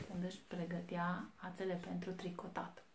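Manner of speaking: ordinary delivery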